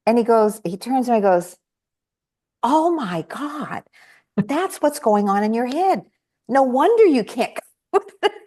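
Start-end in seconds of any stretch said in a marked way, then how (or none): 2.6-8.4 sudden realization. Retold or imitated. Husband at the whiteboard sees his clutter: high astonished realization. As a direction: let the light dawn on him all at once.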